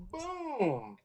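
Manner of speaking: a high, clipped boom like a rifle crack; mock gunshot. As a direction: spit it out like a shot. Mimicking the rifle shots.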